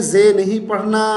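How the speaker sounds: ordinary delivery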